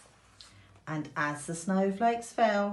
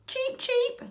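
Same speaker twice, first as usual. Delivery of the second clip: very high chirping chick voice; chirping ducklings. A narrator's reading. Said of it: "chirped the baby ducklings".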